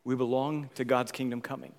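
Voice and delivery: usual speaking voice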